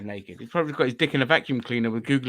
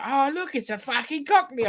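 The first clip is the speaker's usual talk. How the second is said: high-pitched imitation of a northerner; mock northerner; 12 semitones higher than usual. Northerners spot a visiting Londoner, high mocking imitation. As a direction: mimic a northerner spotting an outsider, voice pitched up. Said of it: "go up north and they're like".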